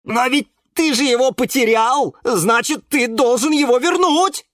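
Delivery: high, loud accusing wail; yelling. A narrator's reading. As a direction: yell the accusation at a friend. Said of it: "завопил Венерина мухоловка Жорж". (громкий высокий вопль)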